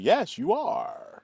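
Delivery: high, slow, drawn-out put-on voice of an older gentleman; eccentric European man. Retelling the producer meeting, imitating his high, slow voice. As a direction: stretch it out high and grand, like an odd old European. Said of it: "That's how he talks".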